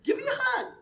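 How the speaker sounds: very high squeaky babushka voice; happy old lady. Retold or imitated. Babushka greeting her American visitor, happy high falsetto. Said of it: "She is all happy and".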